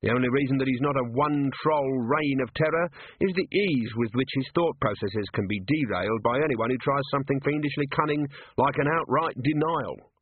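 plain reading voice

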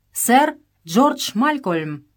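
loud, slow formal announcement; slowly and loudly announce the guest; servant's announcement. A narrator's reading. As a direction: call the name out across the hall. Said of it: "оповестил слуга".

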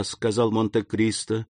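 plain reading voice

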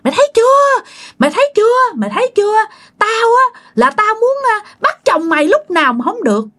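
very high, taunting spirit voice; eerie high-pitched mock triumph; possessed gloating. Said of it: "anh hai vừa cười hả hả hả".

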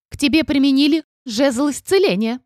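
indignant exclaim. A narrator's reading. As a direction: exclaim it, outraged. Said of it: "возмущённо воскликнул дракон".

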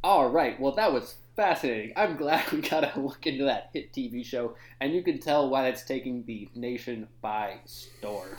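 low, booming show host; host the morning show, deep and loud; radio host voice. Acted.

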